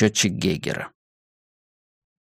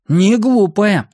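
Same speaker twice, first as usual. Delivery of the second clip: НЕ ГЛУПАЯ drawn out slowly, pitched high; slow, high-pitched agreeing НЕ ГЛУПАЯ; emphatic agreement; 9 semitones higher, 4 dB louder, slower than usual. A narrator's reading. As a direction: stretch НЕ ГЛУПАЯ out in a higher, emphatic voice. Benni emphatically agrees with Joe.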